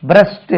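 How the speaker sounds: பிரஸ்ட் as one word ending in a crisp 't'; shown pronunciation. Shown as a demonstration. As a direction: say பிரஸ்ட் with 'ed' sounded as 't'. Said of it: "என்று உச்சரிக்கணும்". (பிரஸ்ட் என 'ட்' ஒலியில் முடி)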